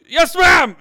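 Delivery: crewman yelling back smartly to an officer; high, loud obedient reply; shouted acknowledgment. Acted. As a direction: shout it like an airman taking orders. Airman answers officer's order.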